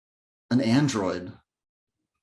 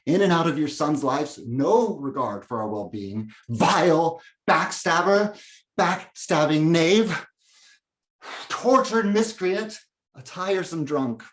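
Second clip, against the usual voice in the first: scornful tirade; 5 semitones higher than usual. Acted. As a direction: spit out each name with contempt. Son's inner tirade against father.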